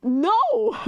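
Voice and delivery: high, incredulous no; a stunned refusal; shocked, high-pitched no; retold disbelief. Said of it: "And I'm like".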